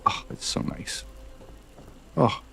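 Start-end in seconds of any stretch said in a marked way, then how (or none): 0.0-1.0 breathy whisper of delight. Real, not acted. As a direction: hush it out admiringly. Admiring the vintage water cooler.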